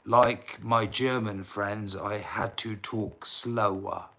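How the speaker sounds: slowed right down, word by word; deliberately slow. Shown as a demonstration. Vlogger slowing down for a viewer puzzled by his accent, deliberately slow. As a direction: spell it out slowly for a listener who can't follow. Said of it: "I'll talk slower for you".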